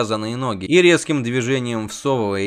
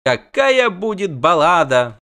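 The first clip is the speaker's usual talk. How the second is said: slow, loud, high rapture; delighted exclaim; 6 semitones higher, 5 dB louder, slower than usual. Acted. Dandelion revels in future ballad, slow and delighted. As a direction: savor the idea.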